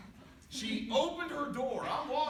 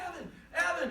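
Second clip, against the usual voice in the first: higher, searching call; imitated calling out; 7 semitones higher than usual. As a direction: mimic a dad calling for his lost kid. Dad calls for missing son.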